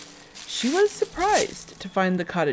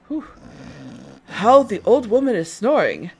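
surprised huntsman; 4 semitones higher, 5 dB louder than usual. Acted.

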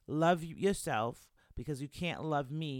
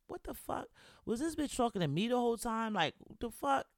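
higher, indignant retold outburst; inner anger; 8 semitones higher than usual. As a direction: replay the flash of anger in your head, pitched up. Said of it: "started to get angry".

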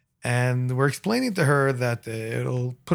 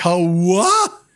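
acted disbelief; 3 semitones higher, 4 dB louder than usual. Acted disbelief at naked runaway.

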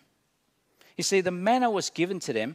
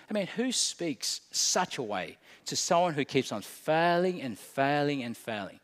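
ordinary talk, nothing marked

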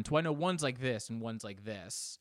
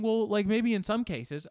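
higher, mildly mocking quote; light, higher-pitched mock protest; imagined listener's objection; 10 semitones higher, 5 dB louder than usual. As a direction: lift the voice for a doubting listener.

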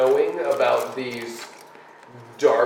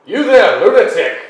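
loud call across a distance; 5 semitones higher, 8 dB louder than usual. Acted. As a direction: hail the stranger from afar. Calling out to distant man.

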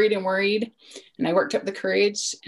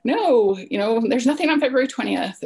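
louder, higher exasperated denial; retold frustration; 5 semitones higher, 8 dB louder than usual. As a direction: shut down a repeated question, loud and fed up. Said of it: "kind of frustrated, and I was like".

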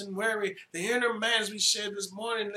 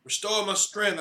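prayerful plea; 5 dB louder than usual. Acted. Preacher opening with prayer.

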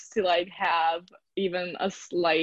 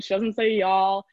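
usual speaking voice

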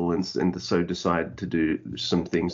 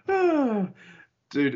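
usual speaking voice

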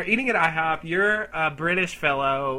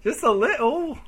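put-on higher-pitched parody of a British accent; mocking British accent; 8 semitones higher, 4 dB louder than usual. Customer admitting he mocks the waiter, in imitated British accent. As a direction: tease by aping his British accent. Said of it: "Are you mocking my accent".

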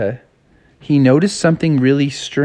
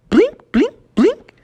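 sound effect. Acted. Mimicking the blinking eyes.

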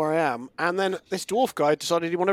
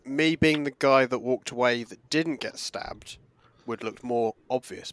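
ordinary talk, nothing marked

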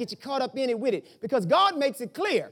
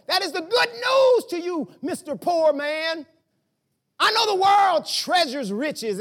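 loud, shouted preaching; preacher shouting the truth at his congregation, full force; 3 semitones higher, 3 dB louder than usual. Acted.

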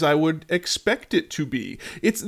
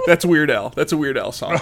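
laughing through the words; chuckling while talking. Real, not acted. Laughing at the joke.

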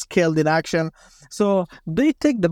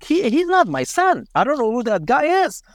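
high, disbelieving mother's voice, retold; a mother refusing to accept the body, pitch climbing; shocked denial; 6 semitones higher than usual. Mother sees the wrong body.

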